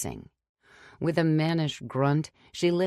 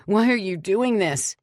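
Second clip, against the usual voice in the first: high, loud frightened squeal; dragged husband begging his captor, squealing; 6 semitones higher, 6 dB louder than usual. A narrator's reading. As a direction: squeal it in terror. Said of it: "he squealed".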